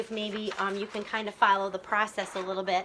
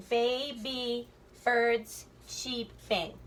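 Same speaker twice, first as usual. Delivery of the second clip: very slow, segmented beats; checking syllables; much slower than usual. Shown as a demonstration. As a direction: separate every syllable for counting. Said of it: "double check the syllable".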